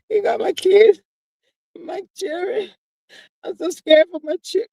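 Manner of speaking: hammed-up distress, pitch up; mock courtroom sobbing. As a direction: fake a breakdown for the court. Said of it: "I put on that show".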